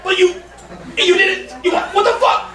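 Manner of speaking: high, laughing disbelief; stunned. Real, not acted. Comic thrown by a heckler, high laughing shock. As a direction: squeak out your shock.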